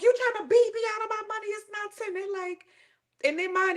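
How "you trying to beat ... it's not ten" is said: mock outraged. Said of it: "We going on like".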